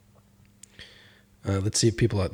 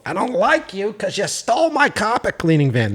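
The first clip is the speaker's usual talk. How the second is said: Columbo actor impression; 9 semitones higher than usual. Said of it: "What's his name who plays Columbo".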